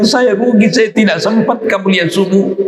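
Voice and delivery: high, grieving plea; tearful lament. Retold or imitated. The companion cries to the Prophet about missing qabliyah, high and pleading. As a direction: wail that you missed the prayer. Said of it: "Dia bilang".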